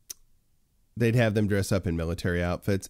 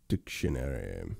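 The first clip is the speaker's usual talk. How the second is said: much lower and softer, slow; low muttered word; 6 semitones lower, 7 dB quieter, slower than usual. Real, not acted. Streamer reading a word off screen in a low murmur. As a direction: murmur it to yourself as you type.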